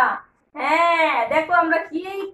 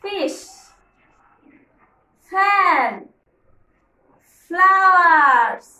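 slow drill ending; 4 dB louder, much slower than usual. Shown as a demonstration. Teacher asking kids to repeat, slow drawn-out drill. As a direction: drill with flowers drawn out slowly. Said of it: "Say with me again".

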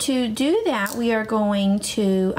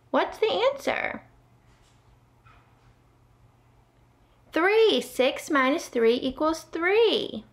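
high excited teacher; 6 semitones higher than usual. Acted. Asking and cheering answer.

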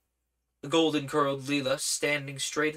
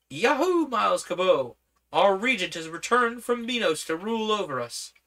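excited cheer; 7 semitones higher, 5 dB louder than usual. Acted. Guards cheer returning regent.

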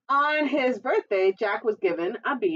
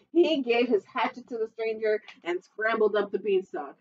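ordinary delivery

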